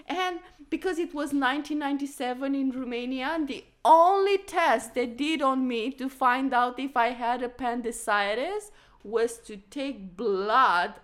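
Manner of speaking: usual speaking voice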